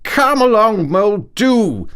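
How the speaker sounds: slow, jolly call back to Mole; cheerful Rat. A narrator's reading. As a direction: wave him along cheerfully. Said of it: "replied the Rat cheerfully".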